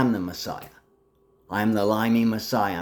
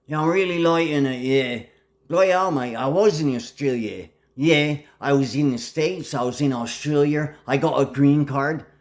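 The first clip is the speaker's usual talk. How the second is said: put-on Australian accent; 4 semitones higher than usual. Waking up as an Australian, mock Australian accent. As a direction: play up a jokey Australian accent. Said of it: "sometimes I'm Australian".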